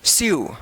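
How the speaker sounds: स्यू alone as "syoo", falling; model reading of sew. Shown as a demonstration. Teacher gives another example for learners, स्यू falling. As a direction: read स्यू as a separate model word. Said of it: "यू उच्चारण होगा".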